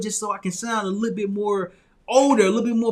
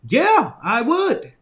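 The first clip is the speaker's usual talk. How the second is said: eager yes; 4 semitones higher, 5 dB louder, much slower than usual. Retold or imitated. Eagerly accepting internship.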